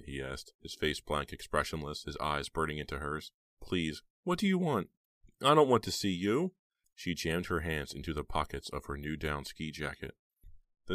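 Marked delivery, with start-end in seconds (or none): 3.6-4.9 high, pleading question; high pleading ask. A narrator's reading. Heather pleading with Snowman. 5.4-6.6 loud, high refusal; loud firm refusal. A narrator's reading. Heather rejecting Snowman.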